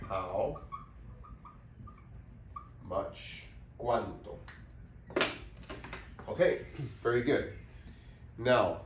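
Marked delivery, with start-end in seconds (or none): none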